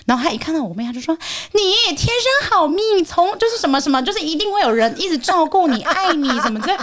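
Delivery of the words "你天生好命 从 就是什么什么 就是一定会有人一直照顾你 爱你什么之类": dote on the lucky sister in a mock sweet voice; gushing fortune teller.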